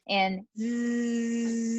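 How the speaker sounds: a voiced zzz hum like a bee; held Z buzz for the patient. Shown as a demonstration. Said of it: "like a buzzing bee".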